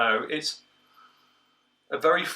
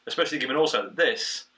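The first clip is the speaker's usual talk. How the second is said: ordinary delivery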